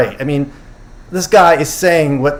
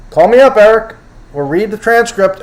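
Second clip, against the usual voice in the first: louder, higher call to Eric; 7 semitones higher, 4 dB louder than usual. Real, not acted. Host calling out to viewer Eric, loud. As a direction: call out to him across the room.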